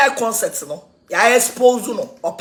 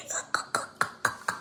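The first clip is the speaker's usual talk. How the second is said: soft, breathy chuckling; hushed laughter; 13 dB quieter than usual. Real, not acted. Speaker laughs at not writing that English, soft breathy laugh.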